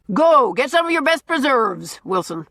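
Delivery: gruff order. A narrator's reading. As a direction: order her about like a blustering master. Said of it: "he ordered".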